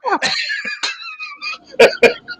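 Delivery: squealing high laugh; high burst of laughter. Real, not acted. Telling the host a funny ghost story, high laugh.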